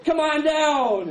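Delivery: high, ringing call to the crowd; revival preacher call. Retold or imitated. Preacher summoning the sick to the stage, high ringing call. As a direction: summon them up like a healer. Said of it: "it's like".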